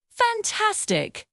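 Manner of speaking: over-the-top cheer for a little learner; big praise. Acted. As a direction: gush over the child's answer. Praising the child's answer.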